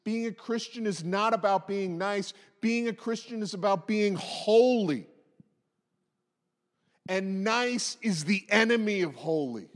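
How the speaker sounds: ordinary delivery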